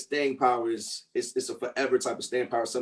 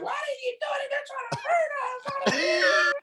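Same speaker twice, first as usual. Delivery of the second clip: high, slow, crying shout; slower than usual. Retold or imitated. Quoting the Jackson Five movie to the hosts, high wailing cry. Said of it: "[name] was like".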